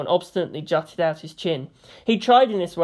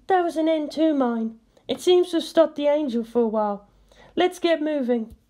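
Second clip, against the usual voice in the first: much higher woman's voice; playful high female voice; Misato; 9 semitones higher than usual. A narrator's reading. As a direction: play a teasing young woman.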